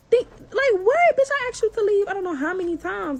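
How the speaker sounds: high, loud disbelief on what; fired-up indignation, voice shooting up; outraged. Real, not acted. Ranting to viewers about the neighbor's nerve, outraged.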